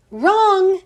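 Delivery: loud, high triumphant cry; exclaiming. A narrator's reading. Teacher pounces on the girl's wild guess, exclaiming. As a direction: shout it out high and hard. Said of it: "exclaimed Missus Jules".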